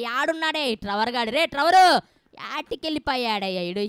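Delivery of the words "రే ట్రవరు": loud, high holler; calling out. Real, not acted. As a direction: shout for someone out of sight. Calling a missing character. (దూరంగా ఉన్నవాడిని గట్టిగా పిలవడం)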